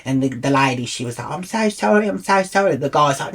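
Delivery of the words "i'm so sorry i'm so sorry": higher-pitched gushing apology; imitated apology. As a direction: mimic the ticket lady apologising, pitched up. Acts out ticket lady apologising.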